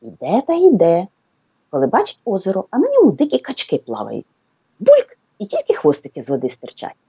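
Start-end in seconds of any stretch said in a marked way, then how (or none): 4.8-5.2 a sharp, high, loud бульк; splash sound. Acted. Narrator imitates ducks diving, high splashy бульк. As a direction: pop the word like a duck diving.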